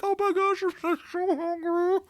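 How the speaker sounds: hammed-up starving coworker; playful falsetto impersonation; excited hungry employees.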